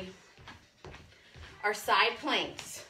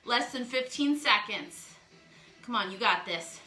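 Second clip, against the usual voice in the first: normal talking voice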